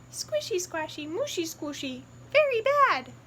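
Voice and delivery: piggy cheekily answering her family; cheeky little piggy. Acted. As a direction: tease them back playfully. Piggy playfully repeating.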